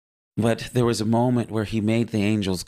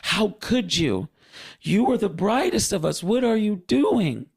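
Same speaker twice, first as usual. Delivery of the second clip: much higher, distressed disbelief; emotional Gabriel; 13 semitones higher than usual. Retold or imitated. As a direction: cry out at a brother's betrayal. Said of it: "who was more emotional".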